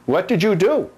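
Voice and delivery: mock scolding owner, What did you do rising; teacher voicing the scolding she gives her dogs. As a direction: ask the dog what it did. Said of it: "asking my doggies that".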